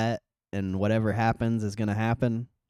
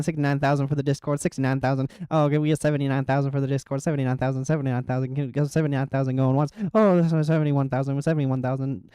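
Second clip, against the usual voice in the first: fast, higher auctioneer patter; 4 semitones higher, faster than usual. Pretend. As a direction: rattle off bids like an auctioneer. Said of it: "Use the auctioneer voice".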